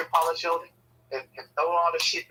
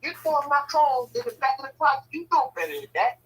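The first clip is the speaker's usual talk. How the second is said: high-pitched, indignant telling-off; acted scolding mother; 9 semitones higher than usual. Mother catching her child hiding clothes in the closet, mock scolding. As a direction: scold a child in a raised pitch, imitating mom.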